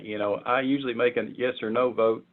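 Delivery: usual speaking voice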